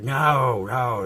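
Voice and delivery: loud high yell of protest; shouting no. Retold or imitated. Neighbor yelling no at his dog.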